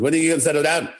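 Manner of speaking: high, lively West Indian mother voice; a mother's West Indian scolding; West Indian accent. Retold or imitated. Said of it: "she had the West Indian accent".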